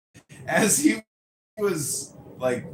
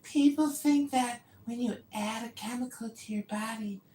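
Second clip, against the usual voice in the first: higher mock voice of Yoni, smug; 10 semitones higher than usual. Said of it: "he was saying that".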